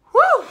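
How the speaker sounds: sudden high-pitched whoop; loud woo. Real, not acted. Vlogger alone doing makeup bursts out, very loud whoop. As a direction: let out a piercing "woo". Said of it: "Sorry, that was pretty loud".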